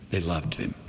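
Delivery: ordinary talk, nothing marked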